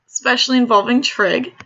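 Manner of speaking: ordinary talk, nothing marked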